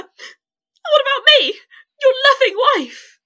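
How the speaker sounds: high, loud, wounded outburst; indignant protest. Acted. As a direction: demand recognition as the wronged wife. Jealous wife confronts her husband.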